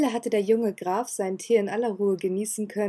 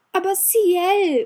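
Ciel drawn out much higher and louder, slow falling protest; upset interruption; 10 semitones higher, 7 dB louder, much slower than usual. Acted. Elizabeth interrupting Ciel as he sends her away, upset. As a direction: cut him off indignantly. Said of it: "unterbrach ihn Elizabeth aufgebracht".

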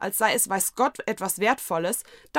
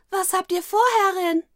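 viel höher, kindliches Mädchen; hohe Kinderstimme; 12 semitones higher, 7 dB louder than usual. Acted. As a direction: hell und neugierig fragen. Dienerin Maru fragt die Hexe. (hohe kindliche Stimme)

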